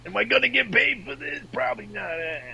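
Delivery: high-pitched hammed-up Brooklyn accent; Italian Brooklyn accent. Performer wondering about pay, hammed-up Brooklyn accent. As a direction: whine it in cartoon Italian Brooklynese. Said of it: "That's definitely an Italian Brooklyn accent".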